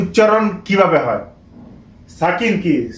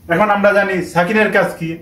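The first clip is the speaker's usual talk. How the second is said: ordinary talk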